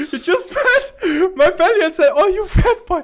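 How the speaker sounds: high, loud words through laughter; laughing while talking. Real, not acted. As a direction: push the words out between laughs. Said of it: "laughing my ass off".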